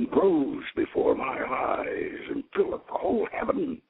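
ordinary delivery

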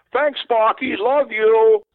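high, loud delighted fan call; imitating a thrilled fan calling out. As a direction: shout thanks to the player. Fan thanking player.

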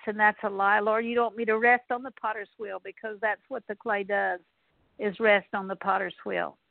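usual speaking voice

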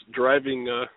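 ordinary delivery

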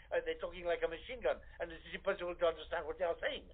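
ordinary delivery

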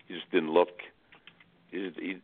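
usual speaking voice

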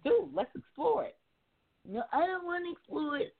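high mocking man voice; sing-song mock of a reluctant guy; mock whiny dude voice. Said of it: "this is not how they sound".

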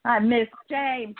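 ordinary delivery

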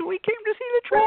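put-on high, quick little kid voice; excited child voice. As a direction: chirp it like an eager small child. Kids arriving at the shop.

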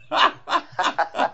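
airy squeal of laughter; real breathy giggle; breathy high laugh. Real, not acted. Laughs after joke.

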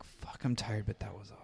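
burned out after a long shoot, said softly; worn-out sigh of relief. Retold or imitated. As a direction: let it drop away, drained but satisfied. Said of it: "just so burned out".

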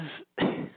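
short, breathy chuckle of disbelief; rueful laugh. Real, not acted. Host laughing at blind support for a politician, rueful laugh. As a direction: laugh so as not to cry. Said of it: "I'd rather laugh than cry".

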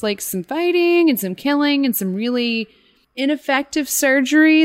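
high, rushed animated retelling; gushing about gory details; excited. Real, not acted. Excited horror recap.

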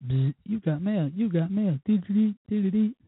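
sing-song phone ping imitation; phone alert noise. Phone buzzing with new mail, chirpy imitation. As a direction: mimic the chiming you-got-mail alert, climbing high.

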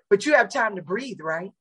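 usual speaking voice

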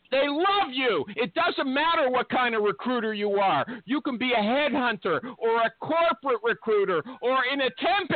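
high, excited sponsor pitch; hyped ad read. Acted. Host plugging his sponsor to recruiters, hyped ad read. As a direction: sell it with rising, over-the-top enthusiasm.

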